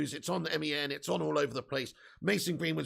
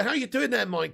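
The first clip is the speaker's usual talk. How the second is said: normal talking voice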